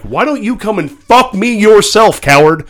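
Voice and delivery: slow, loud, high taunting challenge; defiant comeback. Retold or imitated. Telling the heckler to come fight, loud mock taunt. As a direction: dare him to come over.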